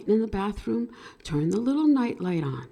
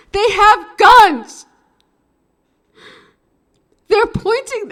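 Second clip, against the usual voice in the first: high, loud, slow near-sobbing shout; panicked girlfriend; 12 semitones higher, 13 dB louder than usual. Retold or imitated. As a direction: voice the terrified girlfriend crying out.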